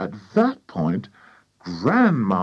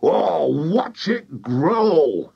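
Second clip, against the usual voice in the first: higher, long drawn-out cry; excited shout; 7 semitones higher, 3 dB louder, much slower than usual. A narrator's reading. Mister Cranky watching the hen, excited drawn-out shout. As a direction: crow it slowly with huge excitement. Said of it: "shouted Mister Cranky".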